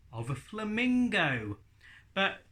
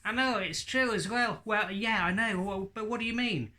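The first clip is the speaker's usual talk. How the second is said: high, cheeky puppet insulting the host; sassy high ventriloquist dummy; 8 semitones higher than usual. Acted. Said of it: "a puppet like Hadley".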